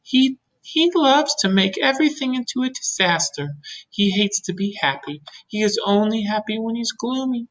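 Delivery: high-pitched, gossipy ladybug voice; confiding ladybug. Acted. Ladybug confides about the earthworm.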